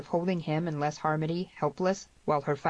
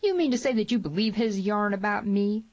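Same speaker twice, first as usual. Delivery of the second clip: raised, indignant question; shout; 5 semitones higher, 4 dB louder than usual. A narrator's reading. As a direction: challenge him loudly, affronted. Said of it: "shouted Tierney".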